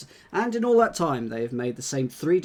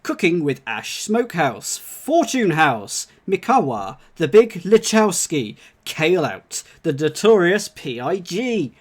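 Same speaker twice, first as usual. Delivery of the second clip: announcer voice; 2 semitones higher, 6 dB louder than usual. Pretend. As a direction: proclaim each name like a big announcer. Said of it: "in your best pronouncer voice".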